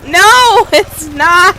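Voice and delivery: high, loud laughing no; loud protest. Real, not acted. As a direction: shout it down, laughing. Protesting loudly.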